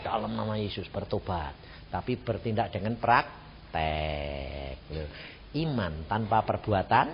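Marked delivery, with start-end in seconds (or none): 0.1-1.6 much softer, hushed "Dalam nama Yesus, bertobat"; hushed silent prayer. Shown as a demonstration. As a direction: murmur it under your breath. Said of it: "Pelan-pelan, dalam hati".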